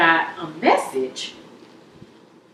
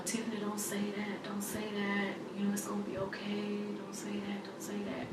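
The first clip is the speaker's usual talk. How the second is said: very soft, urgent comforting murmur; hushed pleading; 3 semitones higher, 17 dB quieter than usual. Retold or imitated. Sister comforting her as she cries, hushed pleading. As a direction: plead gently, barely above a whisper.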